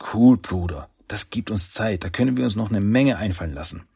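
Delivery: plain reading voice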